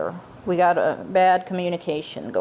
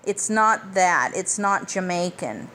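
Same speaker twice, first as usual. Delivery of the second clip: ordinary talk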